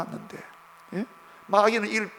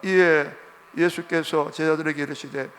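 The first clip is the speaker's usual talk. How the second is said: ordinary talk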